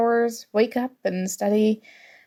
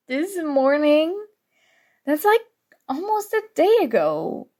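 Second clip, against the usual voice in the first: higher, rising in alarmed disbelief; shocked disbelief; 6 semitones higher than usual. Acted. Scolding a study partner who skipped meals, shocked. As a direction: climb in pitch, can't believe it.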